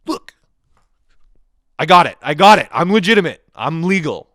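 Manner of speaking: loud, high panicked plea; pleading yell. Retold or imitated. Rider pleading loudly with the bus driver, panicked shout. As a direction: yell it out, desperate to prove the ticket.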